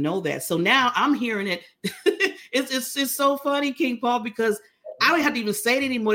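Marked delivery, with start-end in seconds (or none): none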